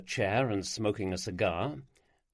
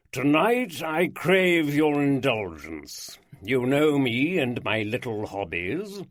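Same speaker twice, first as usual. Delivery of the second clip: slow, louder, pompous lordly voice; a vain lord savouring his own announcement; aristocratic host; 3 semitones higher, 4 dB louder, slower than usual. Acted. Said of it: "rich baritone voice with its aristocratic drawl".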